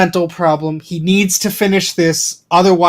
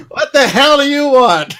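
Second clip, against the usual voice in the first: laughing gruff quote; 8 semitones higher, 4 dB louder than usual. Retold or imitated. Voicing Bruce, laughing.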